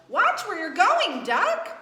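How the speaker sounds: high, loud mock scolding; hammed-up grumpy chicken; cross chicken. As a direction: snap at Duck like an annoyed hen. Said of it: "what he thought was".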